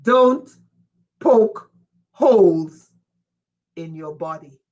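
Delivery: grave warning, long pauses, "in your body" falling almost to nothing; careful warning. Real, not acted. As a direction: hammer "poke holes" one word at a time, then hush. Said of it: "Listen to me very carefully".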